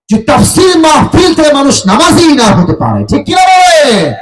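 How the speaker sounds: high, loud challenge to the congregation; fiery sermon demand for agreement; full-force preaching. Real, not acted. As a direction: shout it high, stretching the final question.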